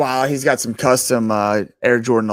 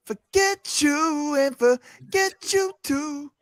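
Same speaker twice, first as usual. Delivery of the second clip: mocking Miz; 13 semitones higher, much slower than usual. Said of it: "Miz is like".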